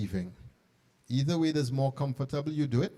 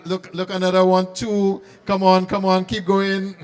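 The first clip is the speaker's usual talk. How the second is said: calling out; 4 semitones higher, 9 dB louder than usual. Real, not acted. Urging staff to pass cards along.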